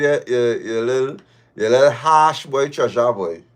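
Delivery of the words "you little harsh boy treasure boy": usual speaking voice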